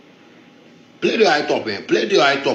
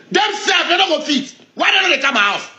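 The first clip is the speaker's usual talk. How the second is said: much higher, slowed shout at the critics; raised shout; 10 semitones higher than usual. Real, not acted. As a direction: throw it up high and drag it out, scornful. Ranting at critics, pitch jumps.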